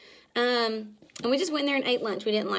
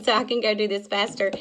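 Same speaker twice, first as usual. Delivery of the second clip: ordinary talk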